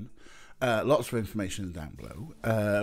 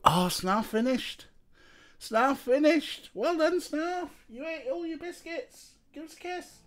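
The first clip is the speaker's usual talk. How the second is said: gushing pet praise. Real, not acted. Praising the cat in baby voice.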